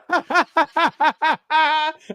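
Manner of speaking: a sudden high peal of laughter; high burst of laughter. Real, not acted. Teasing host laughs at his own joke about Pan, high peal.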